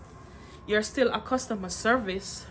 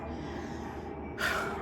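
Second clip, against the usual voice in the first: breathless sigh; 10 dB quieter than usual. Real, not acted. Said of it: "I'm out of breath".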